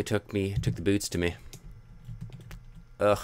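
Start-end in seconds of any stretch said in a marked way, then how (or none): none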